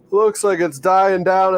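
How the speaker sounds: slow, loud yawned words; yawning. Real, not acted. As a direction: talk through a big yawn. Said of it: "Don't mind my yawning".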